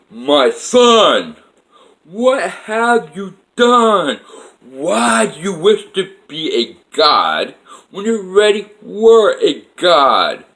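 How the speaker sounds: booming divine voice. Retold or imitated. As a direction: proclaim it like a father-god, drawn out. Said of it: "calm, gentle voice, but really loud".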